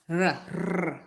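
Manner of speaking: R with a rolled, trilled tongue tip. Shown as a demonstration. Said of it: "rolling my R's".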